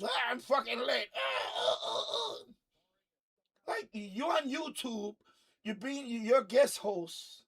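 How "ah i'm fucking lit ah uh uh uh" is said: mock rowdy party shout trailing into squeals. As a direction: ham up the drunk guest host. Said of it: "everything out of her mouth is motherfucking this".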